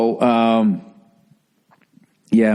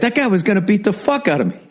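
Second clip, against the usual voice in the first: laughing; 9 semitones higher, faster than usual. Real, not acted. Laughing recalling the near beating.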